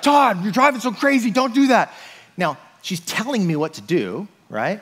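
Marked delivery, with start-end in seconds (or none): 0.0-1.9 freaked-out wife. Retold or imitated. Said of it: "And she's like".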